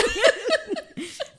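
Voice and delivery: high giggling burst; giggling at the silly word; laughing. Real, not acted. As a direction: crack up. Laughs at doo-doo.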